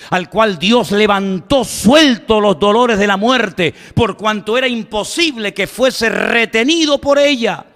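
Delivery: emphatic preacher's recitation in measured phrases; ringing public sermon oratory; declaimed scripture. Acted. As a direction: proclaim the prophecy to a whole crowd. Declaims scripture at full force.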